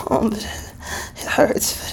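ordinary talk, nothing marked

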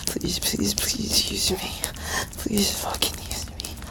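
soft, breathless pleading whisper; whispered begging; whispered plea. Real, not acted. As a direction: beg in a hush.

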